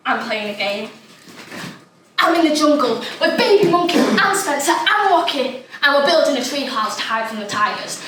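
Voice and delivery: usual speaking voice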